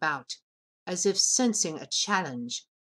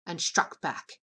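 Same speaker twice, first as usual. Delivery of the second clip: ordinary delivery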